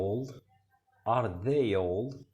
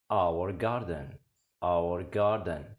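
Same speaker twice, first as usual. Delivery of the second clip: slow, clear phrase for learners; slow model reading; much slower than usual. Shown as a demonstration. Teacher modelling an English phrase slowly for students. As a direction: say it slowly so they can repeat. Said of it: "حديقتنا".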